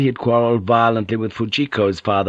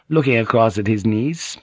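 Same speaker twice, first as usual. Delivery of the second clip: ordinary delivery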